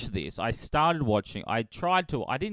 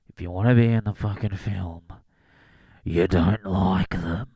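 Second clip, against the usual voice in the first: a hint of a threatening producer; lightly voiced author making a veiled threat; mock J K; 4 semitones lower, 4 dB louder than usual. Voicing J K Rowling.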